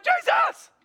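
high, panicked shout to wake someone; hammed-up cry of alarm, pitch leaping way up; freaking out. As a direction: yell it like a terrified disciple. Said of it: "They're freaking out".

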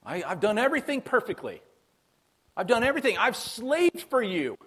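mock the smug martyr; older son whining about his perfect record; self-righteous complainer. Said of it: "He sounds a bit like the rich young ruler".

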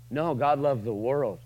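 normal talking voice

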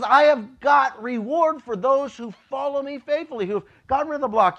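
high, loud proclaiming voice; God promising reward. Retold or imitated. Pastor voices God promising reward to the faithful. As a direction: announce the reward grandly. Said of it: "God says".

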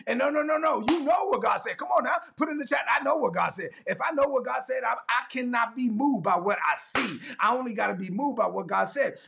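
high-pitched, driving sermon delivery; worked-up preacher, building on repeated phrases; fervent preaching. Real, not acted. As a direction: press each phrase on the congregation. Preaches with rising intensity.

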